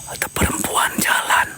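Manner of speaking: hushed ghost-hunt whisper. Real, not acted. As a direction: whisper it so nothing hears. Whispering in the haunted forest.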